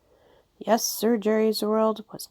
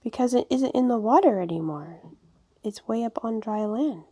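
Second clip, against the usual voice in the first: ordinary delivery, nothing marked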